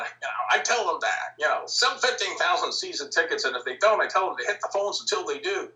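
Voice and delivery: a light, lightly imitated Midwest accent. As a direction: ease into a mild Midwest accent, quoting the old team owner. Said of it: "that Midwest accent".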